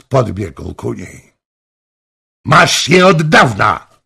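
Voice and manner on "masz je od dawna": loud, higher shout of longing. A narrator's reading. King rushing toward Anna, shouting. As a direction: cry it out to her. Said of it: "krzyknął".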